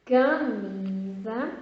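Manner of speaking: camisa said slowly, syllable by syllable; slow careful reading. Shown as a demonstration. Teacher showing camisa slowly to the class. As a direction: spell camisa out with the voice. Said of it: "vou mostrar a primeira palavra".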